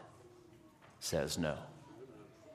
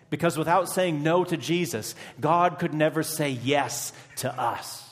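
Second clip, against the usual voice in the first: forceful preaching; 5 semitones higher, 5 dB louder than usual. Real, not acted. Preacher rising to a climax.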